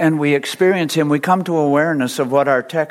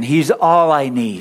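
slow and a bit louder, weight on all; slow call to repeat, firmer; 3 dB louder than usual. Shown as a demonstration. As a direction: call it out again for the room. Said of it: "Say it again".

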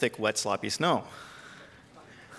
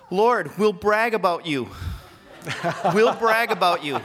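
a hammed-up bargain with God, voice raised; wife's fervent plea; 5 semitones higher, 5 dB louder than usual. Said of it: "And my wife goes".

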